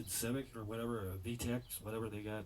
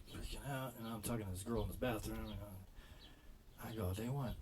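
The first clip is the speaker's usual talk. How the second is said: ordinary talk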